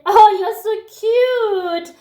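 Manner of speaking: high, drawn-out cutesy coo; gushing admirer. Acted. Guy love-bombs her over baby voice, exaggerated cooing. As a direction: imitate a man fawning, slow and high. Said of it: "and he says".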